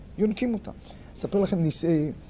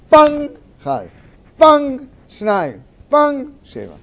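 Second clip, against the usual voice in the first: loud and higher bang, then number; crash counting; 9 semitones higher, 10 dB louder than usual. Retold or imitated. Narrating kids' show bottles falling, loud mock crash count. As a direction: punch each crash like a TV effect.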